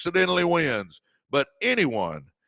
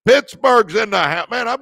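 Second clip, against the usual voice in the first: hype shout-out; 7 semitones higher, 6 dB louder than usual. Real, not acted. Shouting out a viewer's city.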